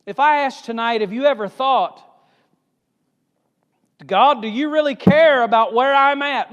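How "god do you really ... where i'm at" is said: raised, higher voice asking God if he cares; doubting cry to God. Acted. Imagined doubting prayer to God.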